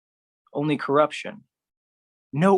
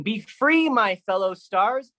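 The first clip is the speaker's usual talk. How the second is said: 1 mock shout; 8 semitones higher than usual. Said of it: "who is shouting".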